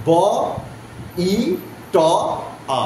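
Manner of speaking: "ব, ই, ট, আ" as four separate sounds; teacher splitting a word into "ব, ই, ট, আ"; sound-by-sound breakdown. Shown as a demonstration. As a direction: sound out "ব, ই, ট, আ" with long gaps. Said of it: "এই চারটে speech sound".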